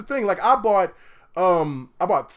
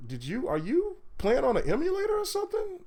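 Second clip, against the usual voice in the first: higher, softer retold question; lightly voiced old lady; 6 semitones higher, 6 dB quieter than usual. Old lady questioning the gamer about an emulator, lightly imitated. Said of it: "the old lady's looking like".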